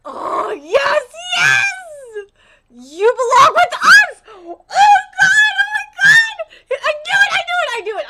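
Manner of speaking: high, loud squealing shouts; ecstatic triumph. Real, not acted. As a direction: scream with joy at being proved right. Celebrates a confirmed guess.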